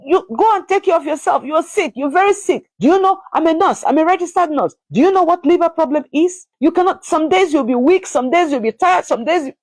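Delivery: high, insistent mimicked berating; scolding tirade. Self-declared nurse berating a sick mother over the baby, high scolding. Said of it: "She blasted me, saying all kinds of things".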